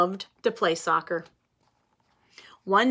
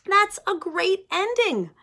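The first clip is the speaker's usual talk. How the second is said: praising sister voice; 10 semitones higher, 3 dB louder than usual. Acted.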